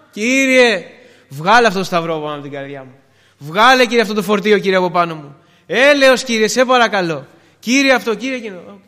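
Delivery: acted cry for mercy, in urgent bursts; desperate pleading. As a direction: implore God to lift the burden. Acted pleading prayer of tested believer.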